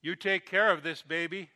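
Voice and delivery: loud, high, firm command; old man firmly charging the mother with the baby. Retold or imitated. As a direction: order it like a charge you mean. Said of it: "in a firm, commanding voice".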